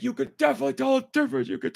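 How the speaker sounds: mocking enthusiast voice. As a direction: parody an eager fanboy.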